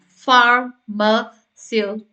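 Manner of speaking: "Pharmaceutical" slowly split into separate syllables; syllable-by-syllable breakdown. Shown as a demonstration. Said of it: "Break it down in your mind".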